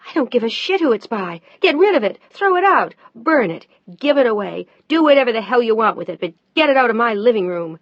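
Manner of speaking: high, loud furious outburst; loud high furious tirade. Acted. Mel furious about portrait.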